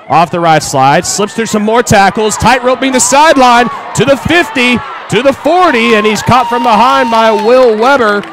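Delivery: rising, shouted play-by-play call; excited play-by-play. Acted. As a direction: call the big run at full voice. Live play-by-play run.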